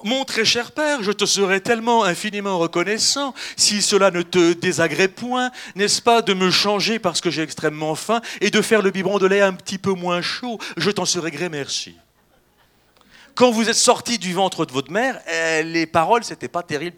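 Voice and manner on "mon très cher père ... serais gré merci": loud, over-formal polite speech, hammed up; mock eloquent newborn. Newborn politely asking father for a change, mock eloquent. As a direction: declaim it like an absurdly courteous baby. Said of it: "vous n'êtes pas sorti du ventre de votre mère en disant".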